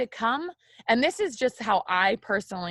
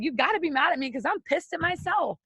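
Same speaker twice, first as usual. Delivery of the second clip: speaking it out; 7 semitones higher than usual. Acted. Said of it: "speak it out loud".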